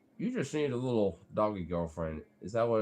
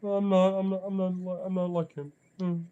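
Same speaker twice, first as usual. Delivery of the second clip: higher-pitched whiny dog voice; mock frustrated dog; 8 semitones higher than usual. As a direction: put on a sulky pup's high little voice. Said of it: "He is so frustrated".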